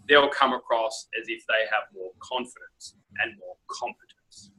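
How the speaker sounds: ordinary talk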